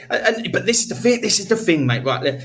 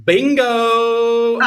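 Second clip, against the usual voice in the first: excited call; 8 semitones higher than usual. Real, not acted. Shouting the bingo plan.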